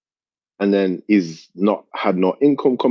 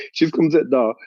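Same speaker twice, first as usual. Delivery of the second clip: ordinary delivery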